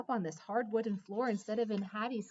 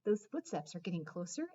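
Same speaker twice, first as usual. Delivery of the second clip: ordinary delivery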